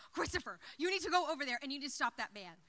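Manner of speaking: fast, loud push at the brother; urgent order. Retold or imitated. Urging brother to stop man.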